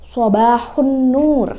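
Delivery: sobahun nur stretched very slowly, the nur held long; drawn-out teacher model of sobahun nur; slow demo. Shown as a demonstration. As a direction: repeat sobahun nur slowly for pupils to copy. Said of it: "nah itu adalah sobahun nur".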